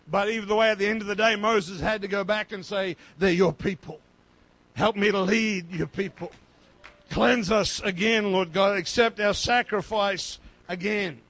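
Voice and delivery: loud, forceful pulpit plea; impassioned preaching. Acted. Preacher voicing Moses' plea for his people, impassioned and loud. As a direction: plead with God at preaching volume.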